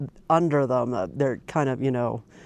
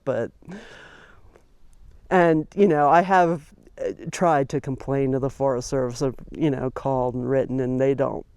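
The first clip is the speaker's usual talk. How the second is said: normal talking voice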